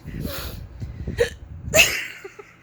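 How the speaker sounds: high unvoiced stifled giggling; breathy giggle. Real, not acted. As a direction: try to hold in the giggles.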